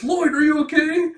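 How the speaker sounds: very high, loud cry; mock alarm. Wife seeing her husband fall, mock alarm. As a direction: call out in hammed-up panic. Family alarmed at father collapsing.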